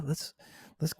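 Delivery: ordinary talk, nothing marked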